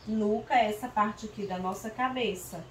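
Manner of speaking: usual speaking voice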